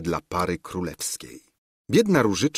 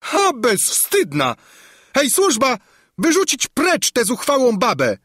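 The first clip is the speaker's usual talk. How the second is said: much higher, louder enraged screech at the servants; furious scream; 4 dB louder than usual. A narrator's reading. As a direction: order her thrown out in a rage. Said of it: "wrzasnęła rozwścieczona". (wściekły wrzask hrabiny)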